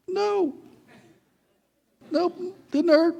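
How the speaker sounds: very high, strained squeak; pained denial. Retold or imitated. Boy hiding the pain from his brother, squeaky pained denial. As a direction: squeak out a badly faked denial.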